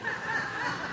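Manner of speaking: quick high squeal of a laugh; a burst of laughter at his own joke; a short high-pitched laugh. Real, not acted.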